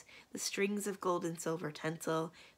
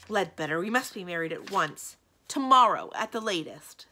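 loud, impatient lover; urgent demand; 10 dB louder than usual. Acted. Magician insisting to his servant on marrying at once, urgent. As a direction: press it urgently, loudest on the key word.

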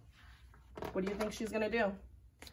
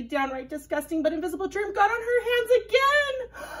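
higher and louder, building up; rising excitement; 10 semitones higher, 5 dB louder than usual. Acted. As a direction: build the drama to the end. Building dramatic germ list.

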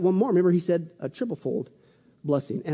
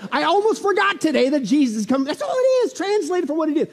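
hammed-up excited realisation; 8 semitones higher, 4 dB louder than usual. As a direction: voice leaping very high. Acts sudden reminder of Jesus' return.

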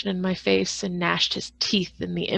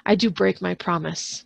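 plain reading voice

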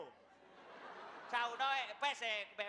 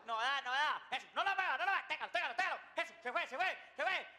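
high, repeated cries building in excitement; mock-frantic launch commands; excited urging; 4 semitones higher, 4 dB louder than usual. Helpers watching the balloon lift off, acted with high excited urgency.

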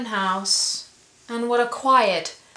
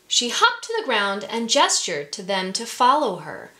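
ordinary delivery

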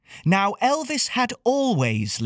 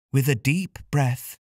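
plain reading voice

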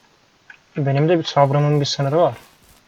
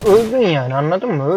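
angry; 3 semitones higher than usual. Real, not acted. Shoots the animal in anger.

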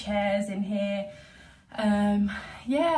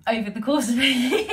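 laughing; 4 semitones higher, 10 dB louder, faster than usual. Real, not acted. Laughing at own messy filming.

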